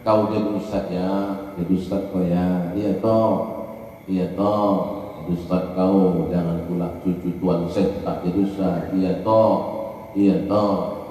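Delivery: put-on low, soft voice of the old grandfather; a deep, hushed old sheikh giving his blessing. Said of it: "Dia dulu katanya". (suara kakek tua yang rendah dan pelan)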